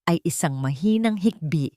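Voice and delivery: ordinary delivery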